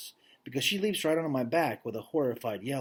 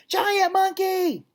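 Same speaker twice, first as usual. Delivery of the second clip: very high, loud shriek of fright; cat's scream; 6 dB louder, much slower than usual. Acted. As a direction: shriek it like a terrified cat. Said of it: "screams the orange cat".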